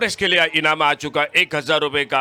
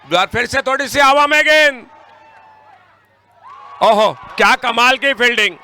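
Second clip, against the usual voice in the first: high, loud shouting for a hat-trick; excited cricket commentary; 5 semitones higher, 3 dB louder than usual. Real, not acted. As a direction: cheer the catch at full voice. Hat-trick catch called live. (उत्साहित cricket commentary)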